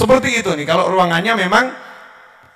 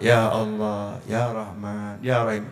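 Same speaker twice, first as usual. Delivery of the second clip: much softer and lower, listless; limp prayer; 10 semitones lower, 10 dB quieter, slower than usual. Shown as a demonstration. Preacher mocking a limp prayer, faint and low. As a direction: mumble the names like a weary beggar. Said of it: "Kayak duit sak kepok".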